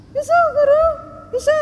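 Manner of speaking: put-on high childlike yes; a child happily agreeing; eager little girl.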